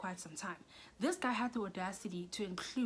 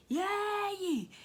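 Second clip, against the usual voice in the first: high, loud drawn-out exclamation; mock cheer; 13 semitones higher, 7 dB louder than usual. Reacting to him calling her name, loud drawn-out cry. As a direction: let out a long, high mock yay.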